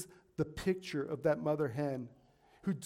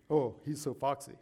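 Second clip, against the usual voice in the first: mock admiring; 3 semitones lower than usual. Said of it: "he wasn't being, you know, nice".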